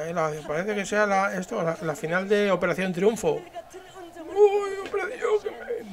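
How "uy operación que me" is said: very high squeaky put-on voice; put-on character voice. Dubbed fan reacting to the leader, squeaky high voice. Dubs an adoring voice.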